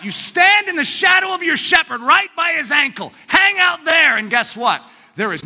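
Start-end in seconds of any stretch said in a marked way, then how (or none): 0.0-4.2 louder, higher pulpit shout; rally them to the shepherd; preaching at full force. Acted. Preacher urging listeners to stay by the shepherd, shouting.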